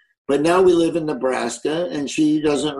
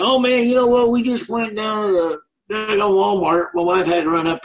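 lightly put-on Southern country accent, a bit higher; Southern accent like Brad; 8 semitones higher than usual. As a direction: slip into Brad's Southern twang. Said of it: "I'm talking just like Brad".

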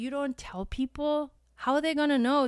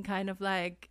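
ordinary talk, nothing marked

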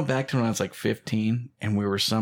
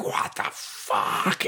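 slow, breathy stunned whisper; stunned father whispering at the news; dad's stunned whisper; 2 semitones lower, much slower than usual. Retold or imitated. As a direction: let it out in slow disbelief. Dad reacting, voiced in story.

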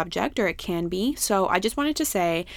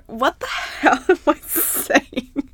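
laughing; 6 semitones higher, 5 dB louder, slower than usual. Real, not acted. Laughs at her own stumble.

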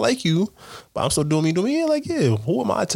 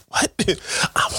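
whisper; 6 semitones higher than usual. Pretend. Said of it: "why you whispering".

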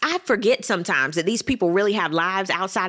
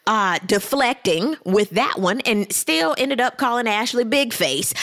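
ordinary talk, nothing marked

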